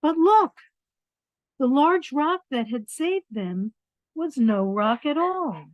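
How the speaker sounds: lively storybook reading; reading to children. A narrator's reading. Reading a picture book to children, lively storybook voice. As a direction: read it out warmly to the little ones.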